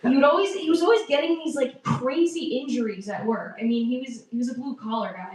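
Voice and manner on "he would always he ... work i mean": ordinary talk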